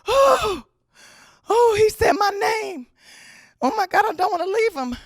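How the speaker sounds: high, loud, melting outburst; overcome with emotion. Retold or imitated. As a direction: act out a heart-struck big sister, almost wailing. Her heart melts hearing her brother.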